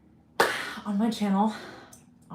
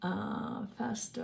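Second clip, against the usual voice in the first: usual speaking voice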